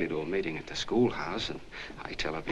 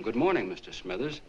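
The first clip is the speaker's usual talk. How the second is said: restrained neighborly hail, lightly raised; mild retold call; 3 semitones higher than usual. Husband greeting the deaf neighbor over the hedge, mild retold call. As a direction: call over politely, only half raising the voice. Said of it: "so I yelled over to him".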